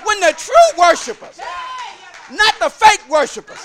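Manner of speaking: loud, high shouted preaching; full-force preaching. Acted. Preacher rousing the church, loud and shouting. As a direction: drive every phrase home to the back pew.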